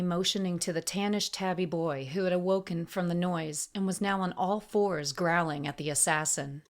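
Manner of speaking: ordinary delivery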